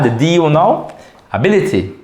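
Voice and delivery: ordinary talk, nothing marked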